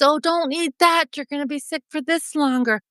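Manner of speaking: high-pitched, eager caution; acted excited urging; excited warning. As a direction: blurt advice to your younger self. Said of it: "I was just so excited".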